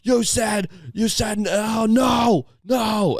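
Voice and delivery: high, shouted mock rant at his own reflection; frantic high-pitched yelling impression; ranting junkie. Imitating a man screaming at himself.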